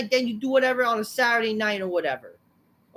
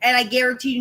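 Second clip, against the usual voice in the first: usual speaking voice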